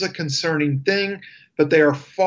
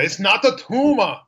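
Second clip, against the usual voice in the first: put-on Arnold Schwarzenegger voice, higher, thick Austrian accent; Schwarzenegger impression; 6 semitones higher than usual. Host shows co-host his Schwarzenegger impression, thick Austrian accent. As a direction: quote Schwarzenegger's famous line in his accent. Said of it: "I can do the Schwarzenegger".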